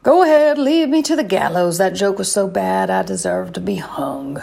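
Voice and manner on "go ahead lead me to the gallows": higher and louder hammed-up martyr plea; mock-dramatic despair. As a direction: ham it up as if facing the gallows. Mock despair after a bad joke.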